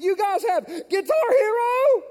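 put-on high, slow, wide-eyed kid asking; a boy marvelling at the game room, high and drawn out; excited kid voice. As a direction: play the awed fifth grader. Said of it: "kid voice again".

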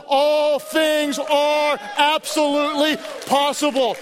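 slow shouted proclamation; preaching shout. Real, not acted. Pastor encouraging the congregation, slow preaching shout. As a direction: proclaim it slowly to the whole church.

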